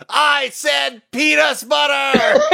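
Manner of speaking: high, drawn-out mock scream; retold scream. Customer screaming at the waiter in a packed restaurant, mock scream. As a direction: scream it slowly in indignant imitation. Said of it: "she screams at the top of her lungs".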